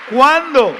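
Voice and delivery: loud, rising sermon delivery; preaching at full force. Acted. Pastor preaching loudly to his congregation, full force.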